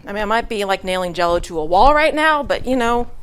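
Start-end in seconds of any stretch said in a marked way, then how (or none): none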